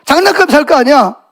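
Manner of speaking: high, irritated scolding; annoyed. Acted. Irritated father sending his little son to bed, annoyed. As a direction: send the child off to bed crossly. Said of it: "아빠가 막 짜증이 나요".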